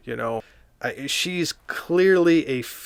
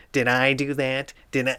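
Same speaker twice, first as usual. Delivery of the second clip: nerdy sitcom catchphrase voice, more put on; hammed-up nerd apologizing; a fuller Urkel whine; Steve Urkel impression. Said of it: "Steve Urkel just coming in and going".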